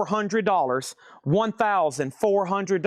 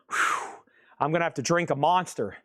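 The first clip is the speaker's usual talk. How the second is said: ordinary talk, nothing marked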